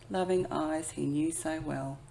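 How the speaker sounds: ordinary delivery, nothing marked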